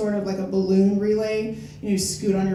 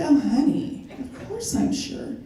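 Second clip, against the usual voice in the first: sarcastic teasing; 6 semitones higher than usual. Retold or imitated. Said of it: "I was like".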